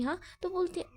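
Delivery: plain reading voice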